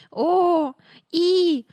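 put-on high, loud baby squeal; squealing babies. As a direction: twins shrieking with delight. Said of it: "верещали близнята". (високий гучний вереск малюків)

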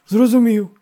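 high, loud, eager; delighted yes to a master; joyful assent. A narrator's reading. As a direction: agree gladly and obediently. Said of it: "радісно закивав староста".

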